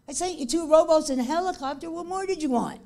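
God scolding the man who wouldn't listen; exasperated God. Retold or imitated.

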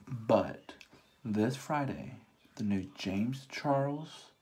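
usual speaking voice

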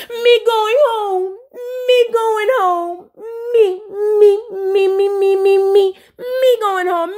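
high, slow mock wail; hammed-up tearful whine, stretched out; Spice. Spice threatens to leave dinner, high mock wail. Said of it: "And look".

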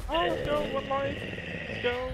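high, excited cheering; hyped. Real, not acted. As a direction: pump up the team. Clutch round hype.

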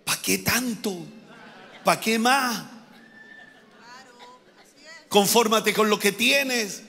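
a hint of a slow, resigned objector; lightly voiced Chilean churchgoer. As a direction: lightly act a brother discouraging ambition, slowly. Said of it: "un hermano evangélico chileno diría".

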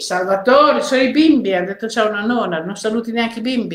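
normal talking voice

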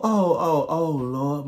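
usual speaking voice

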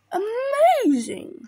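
high, loud, stretched exclamation; amazed Gruffalo. Acted. Gruffalo sees snake flee the mouse, amazed. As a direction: draw it out in wonder.

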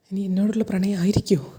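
a secret hopeful whisper to himself; whispered thought. Retold or imitated. Govind wondering to himself if she loves him, whispered. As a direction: whisper it, hoping it is love.

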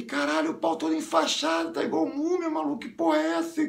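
high-pitched exclaiming in mock horror; shocked outcry. Friend gawking at the bandaged privates, high shocked outcry. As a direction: cry out in appalled disbelief.

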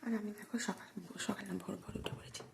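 hushed whisper, family still asleep; soft whisper. Real, not acted. Early morning cook whispering to viewers so the family sleeps, soft whisper. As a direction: keep it to a whisper so no one wakes. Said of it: "আর আমি আস্তে আস্তে কথা বলছি".

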